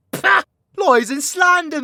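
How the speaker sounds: hammed-up outrage at the accusation; scoffing denial. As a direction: scoff it off theatrically. Denying accusation.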